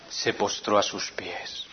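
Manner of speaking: slow unvoiced whisper. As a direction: let it sink, whispered slowly. Misma frase, susurrada. (susurro lento y reverente)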